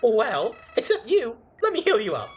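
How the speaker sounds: sing-song sweetness with a sly edge; Flowey voice. Acted. Player reading Flowey's lines to Clover in a cheerful character voice.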